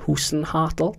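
Hasenhüttl with U and A swapped, like "Hoosen-hartl"; mispronounced name. Real, not acted. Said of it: "your U and your A's mixed up".

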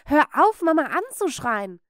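put-on girl shouting at grandmother to stop; loud command. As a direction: cut her off hard. Standing up to grandma.

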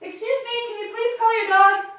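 high-pitched distant call. Shown as a demonstration. Practising the cue on a walk, calling out. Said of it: "in a loud voice".

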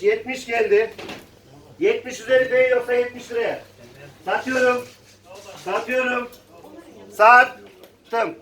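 calling out bids at auction; rhythmic auction calling; auctioneer. Real, not acted.

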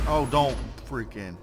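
ordinary talk, nothing marked

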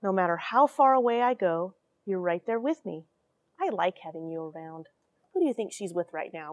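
normal talking voice

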